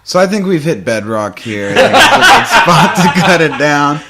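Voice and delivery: laughing. Real, not acted. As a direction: laugh through the sign-off. Laughing wrap-up.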